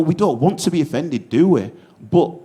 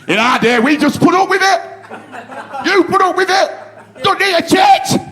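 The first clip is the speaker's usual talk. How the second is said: put-on loud, high, ranting old-timer; grumpy old traditionalist; 12 semitones higher, 6 dB louder than usual. Online commenter complaining about change, ranting old-timer voice. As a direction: rant like a blustering bloke who hates change.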